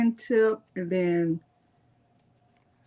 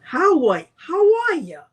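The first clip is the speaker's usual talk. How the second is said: cheerful greeting; 6 semitones higher, 6 dB louder than usual. Real, not acted. Greeting viewers in chat.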